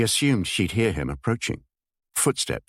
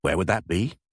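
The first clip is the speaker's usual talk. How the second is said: put-on Scottish accent stronger, with a rise on that; more pronounced Scottish accent. Irritated man pressing the chief constable, stronger Scottish accent. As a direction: lean harder into the Scottish accent. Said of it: "The Scottish accent was a bit more pronounced now".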